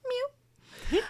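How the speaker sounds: high tiny kitten mew. Acted. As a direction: squeak it like a kitten. Kitten mewing.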